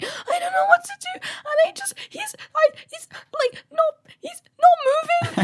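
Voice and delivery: high, wailing, sobbing panic; imitating sobbing. Retelling the phone call to mum, sobbing high. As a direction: sob it out high and broken. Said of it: "levels of sobbing".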